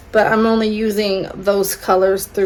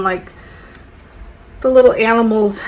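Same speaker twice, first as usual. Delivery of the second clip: ordinary delivery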